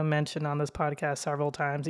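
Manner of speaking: ordinary talk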